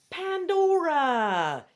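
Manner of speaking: loud, stretched-out delighted cry; excited exclaim. Acted. Girl spotting her friend, exclaiming with joy. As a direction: greet a friend you've missed. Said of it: "you exclaim".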